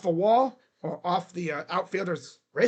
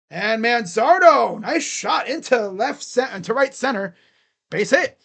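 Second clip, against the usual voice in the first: loud, stretched-out hit call; real excited sports call; excited play-by-play; 4 semitones higher, 7 dB louder than usual. Real, not acted. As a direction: call the shot with rising excitement.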